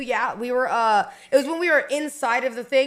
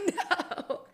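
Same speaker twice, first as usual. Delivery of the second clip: whiny no; 7 semitones higher, 6 dB quieter than usual. Retold or imitated. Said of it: "And I was like".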